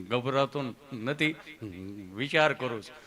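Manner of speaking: low, hesitant patient; Gabho. Acted. Patient telling the nurse he is thinking, low and hesitant. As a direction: hum and ponder in a low voice. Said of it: "અહીંયા ગભો બેઠો હતો".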